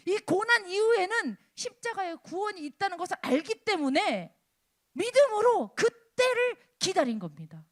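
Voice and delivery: loud, high, swelling sermon delivery; forceful preaching. Acted. Preacher urging congregation to see faith in waiting, forceful preaching. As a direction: press the point on the congregation with full conviction.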